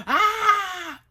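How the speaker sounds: high, loud startled yelp; startled cry. Acted. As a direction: jump up with a sudden shocked cry. Bather startled in story.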